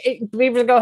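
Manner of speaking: usual speaking voice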